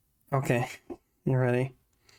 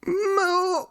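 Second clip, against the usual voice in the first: high, strained near-shouted moo; 14 semitones higher than usual. Pretend. Streamer redoes the cow redeem for chat, near-shouted moo. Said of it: "I don't know how to do it without shouting".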